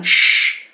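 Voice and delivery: "Sh" as a long unvoiced hush. Shown as a demonstration. Teacher showing how SCH sounds, hushing sh. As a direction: hiss "Sh" like silencing someone. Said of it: "como quando mandamos calar alguém".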